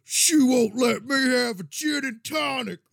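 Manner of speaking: put-on high, loud furious drunk; drunk raging. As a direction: rage like a wasted friend. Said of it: "Like, just raging".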